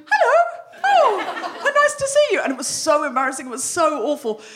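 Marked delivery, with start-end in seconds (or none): 0.1-2.4 high, loud, sugary hostess voice; fake polite greeting. Comedian greets waiting fans after screaming, high and loud. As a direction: switch to gracious hostess. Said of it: "change my face from hysterical ... to".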